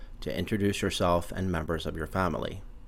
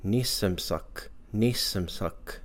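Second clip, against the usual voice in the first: nisamsak drawn out slowly and softly for learners; slow demo; 3 dB quieter, slower than usual. Shown as a demonstration. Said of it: "My younger siblings or younger cousins".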